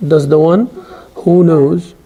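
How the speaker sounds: ordinary talk, nothing marked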